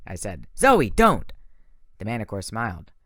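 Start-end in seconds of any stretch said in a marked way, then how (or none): 0.6-1.3 urgent warning. A narrator's reading. Hero stops archer urgently.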